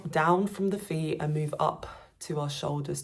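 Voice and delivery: normal talking voice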